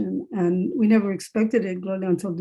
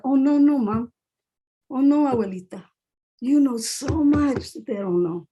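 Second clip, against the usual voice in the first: insisting to family; 4 semitones higher than usual. Retold or imitated. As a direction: gently set mother and grandmother straight, stretching the words. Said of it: "tell our families that".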